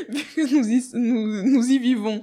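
real laugh breaking into the words; laughing while talking. Real, not acted. Guest replying that we live on Earth, laughing. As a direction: stumble through the phrase, giggling. Guest cracks up mid-discussion.

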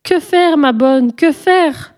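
high-pitched anxious cry for help. A narrator's reading. Sophie begging her nanny for advice, high cry. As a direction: call out in a high, pleading voice. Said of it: "s'écria Sophie".

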